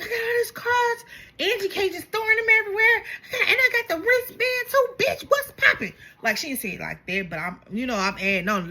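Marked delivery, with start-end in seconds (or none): 0.0-5.9 mock bragging Angie. Narrator mocks Angie bragging about her cards, gloating high voice. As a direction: show off your cards like a queen. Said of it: "she was like".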